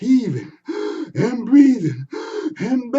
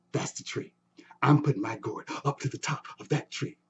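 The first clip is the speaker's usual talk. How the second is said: secret whisper; 5 semitones lower, 8 dB quieter than usual. Acted. Anansi's secret plan, hushed.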